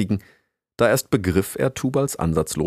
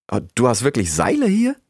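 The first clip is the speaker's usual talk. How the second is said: higher, rising astonished question; 5 semitones higher, 4 dB louder than usual. A narrator's reading. Rix learns the beaver has rope, astonished. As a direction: ask it in mild amazement. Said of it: "fragte Rix erstaunt".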